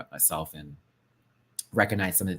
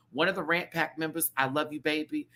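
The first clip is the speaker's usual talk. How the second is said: ordinary talk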